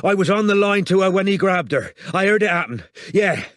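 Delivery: panicked emergency call; urgent. Acted. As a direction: rush the report out in alarm.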